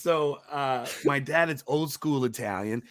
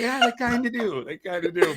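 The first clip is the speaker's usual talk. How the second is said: laughing reply; 10 semitones higher than usual. Retold or imitated. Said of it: "I'm like".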